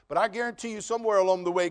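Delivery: usual speaking voice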